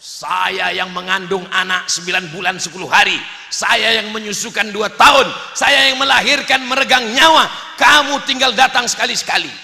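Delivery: building indignant tirade; acted furious complaint, rising; imitating raging wife. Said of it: "Dia malah ngamuk".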